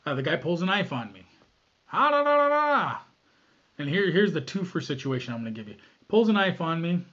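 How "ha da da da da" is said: high, loud jokey stabbing sound; playful high yelp; mock attack noise. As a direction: voice a lunging attacker. Mimicking a knife attack noise.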